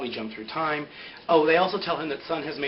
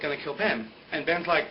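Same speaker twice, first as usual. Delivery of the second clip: normal talking voice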